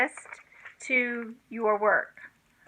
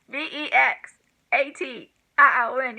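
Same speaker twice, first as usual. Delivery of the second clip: ordinary delivery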